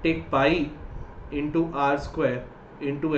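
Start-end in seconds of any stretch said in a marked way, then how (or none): none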